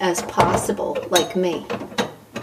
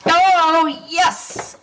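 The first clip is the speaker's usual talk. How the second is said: excited cheer; 9 semitones higher than usual. Real, not acted. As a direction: cheer the win. Faucet nut finally comes loose.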